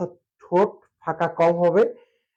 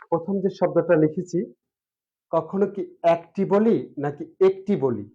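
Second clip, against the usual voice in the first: usual speaking voice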